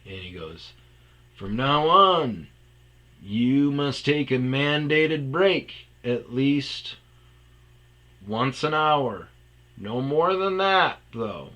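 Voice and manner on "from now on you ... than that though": slow, louder, higher decree; mock-stern boss. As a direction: announce it like an official rule. Owner announcing policy grandly.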